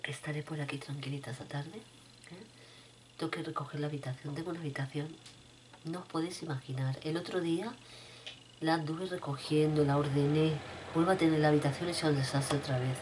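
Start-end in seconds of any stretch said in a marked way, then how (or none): none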